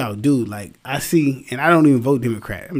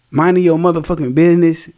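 loud; 5 semitones higher, 6 dB louder than usual. Real, not acted. Ranting to Karens.

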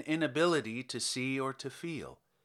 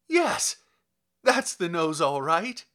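joyful shout; 3 semitones higher, 7 dB louder than usual. A narrator's reading. Kovalev shouting with joy as he sees the nose. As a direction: burst out in delight. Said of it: "Kovalev shouted".

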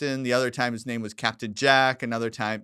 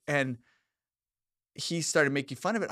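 ordinary delivery

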